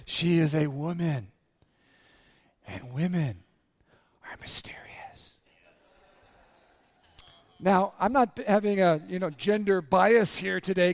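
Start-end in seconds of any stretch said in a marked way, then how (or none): 2.7-5.4 dramatic whisper; hushed awe. Real, not acted. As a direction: drop to a whisper for mystery. Hushed punchline about women's mystery.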